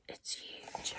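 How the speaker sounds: whispering. Real, not acted. Awed whisper about the snake.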